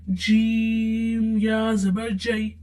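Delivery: slow spelling of जीम या जबर जई; slow spelled-out reading. Shown as a demonstration. Teacher demonstrating to students, slow and careful. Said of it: "teacher demonstrates spelling".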